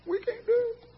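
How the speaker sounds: high, soft, whimpering mock despair; mock whiny defeatist. Preacher mocking teammates moaning in the dugout, high whimper. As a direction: whine like a beaten teammate. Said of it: "people in there saying".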